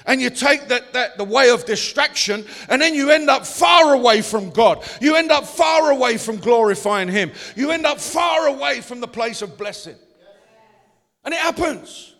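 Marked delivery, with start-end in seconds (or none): 0.0-10.0 loud, driving sermon climax; preaching at full force. Acted. Pastor warning the congregation about distraction, preaching loud. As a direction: hammer each line home to the congregation.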